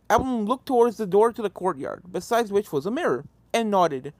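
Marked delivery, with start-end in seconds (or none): none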